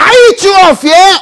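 high, loud rebuke at fear; rebuking fear at full voice; shouted rebuke. Real, not acted. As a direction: bark it at the fear. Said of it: "shouting at fear".

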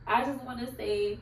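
ordinary delivery

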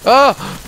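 sharp, loud high yelp of fright; short shocked cry; startled yelp. Real, not acted. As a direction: jump at a sudden scare. Sudden scare in game.

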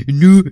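Nu said loud and short; mock-dialogue Saxon Nu, loud. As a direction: Saxon yes in play dialogue, Nu. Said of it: "Dein Bibus stinkt".

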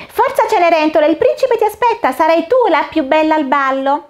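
high, bright-eyed fairy godmother, cheerful and busy; the fairy godmother greets Cinderella at the pumpkin, high and cheery. Acted.

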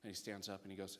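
normal talking voice

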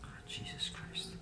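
hushed scared whisper; whispering while hiding. Real, not acted. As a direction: whisper so granny can't hear.

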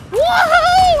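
shrill-high joyful whoop. Real, not acted. Gamer escaping the fight, high whoop. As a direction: whoop like a rollercoaster. Fleeing, cheering.

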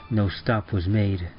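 plain reading voice

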